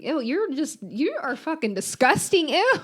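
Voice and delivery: high, grossed-out squeal; disgusted. Real, not acted. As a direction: recoil in disgust. Grossed out by partner's joke.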